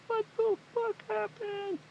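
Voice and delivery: high, breathy squeak of disbelief; mock stunned disbelief. Reacting to the crushed face scene, high mock disbelief. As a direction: voice the viewer's shock in a high squeak. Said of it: "And you're just like".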